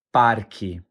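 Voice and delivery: "Parque" with the R clearly sounded, PAR-kee; standard pronunciation. Shown as a demonstration. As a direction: pronounce the R in "Parque", falling at the end. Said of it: "Let's say it one more time".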